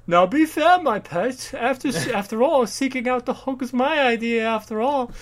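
high, pompous, patronising voice; mock condescending father; smug rich father. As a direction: talk down to the daughter grandly. Voicing father character.

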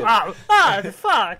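loud hammy burning cries; mock pained yell. Dracula burning on the Star of David, hammed-up yelps. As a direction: cry out as a scalded vampire.

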